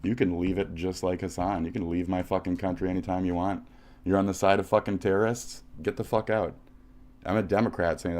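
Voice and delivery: usual speaking voice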